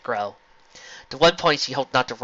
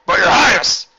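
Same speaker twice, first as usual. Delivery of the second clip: loud protesting cry; 7 semitones higher, 11 dB louder than usual. A narrator's reading. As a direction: object loudly. General protests.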